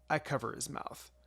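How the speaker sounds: ordinary delivery, nothing marked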